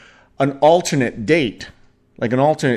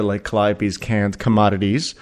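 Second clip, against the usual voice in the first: ordinary delivery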